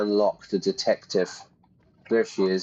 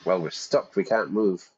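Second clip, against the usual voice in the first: normal talking voice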